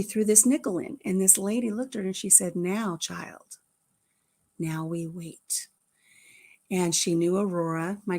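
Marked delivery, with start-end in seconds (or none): 2.6-5.7 slow, low, hushed pronouncement; solemn instruction. Retold or imitated. Lady to the child after the nickel throw, hushed and solemn. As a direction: lower it and slow right down.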